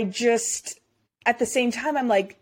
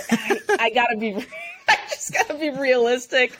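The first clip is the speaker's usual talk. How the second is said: laughing; 7 semitones higher than usual. Real, not acted. Laughing through her answer.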